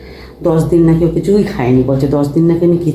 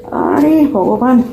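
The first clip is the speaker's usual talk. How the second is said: exasperated; 7 semitones higher, much slower than usual. Real, not acted. Struggles to film one-handed with sore back.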